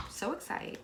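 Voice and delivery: soft whispered repeat of So excited; a whispered echo of So excited. Shown as a demonstration. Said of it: "I'm so excited".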